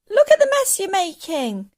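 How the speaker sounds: put-on upset child calling up; protesting boy. A narrator's reading. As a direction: scold her loudly.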